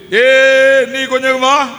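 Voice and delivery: loud call to the deaf man; Jesus calling out. Acted. Preacher voicing Jesus calling the deaf man, loud call. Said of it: "அப்படின்னாரு".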